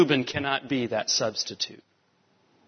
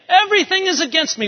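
mock self-pity; 13 semitones higher, 4 dB louder, faster than usual. Mimics anyone's self-pitying complaint.

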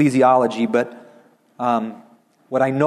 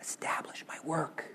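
very soft whispered reminder; little whisper; 18 dB quieter than usual. Acted. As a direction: whisper it gently to remind them. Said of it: "in a little whisper to remind us".

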